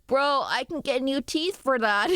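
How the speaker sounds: normal talking voice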